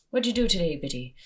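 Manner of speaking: high-pitched start on a bad Irish accent; terrible Irish accent. Imitating a guy in a Galway pub, bad Irish accent. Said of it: "That was a terrible accent".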